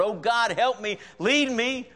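lightly hammed-up praying; mock pleading prayer. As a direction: beg God in a mock-earnest plea. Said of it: "how many times I pray".

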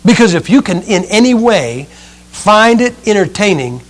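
usual speaking voice

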